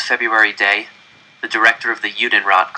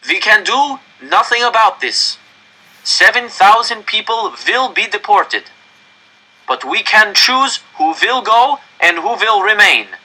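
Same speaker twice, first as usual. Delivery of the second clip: director's raised, higher public address; stern official; 11 semitones higher, 5 dB louder than usual. Acted. Director warning the crowd into the microphone, raised and stern. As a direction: command the whole square from the microphone.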